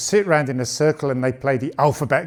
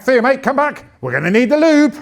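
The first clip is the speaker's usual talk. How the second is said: higher, loud call after someone running off; 8 semitones higher, 4 dB louder than usual. Retold or imitated. Dad calling his son back, loud call. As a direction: shout after him across the room.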